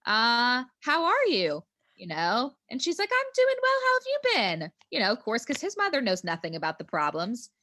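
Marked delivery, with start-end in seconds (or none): 0.0-1.6 high, put-on friendly hello; lilting, hammed-up pleasantries; acted cheery greeting. Acts out greeting to boyfriend's mother. 3.1-4.7 very high, loud put-on pleasantries; high-pitched mock small talk; imitated chirpy mother. Voices the boyfriend's unsuspecting mother.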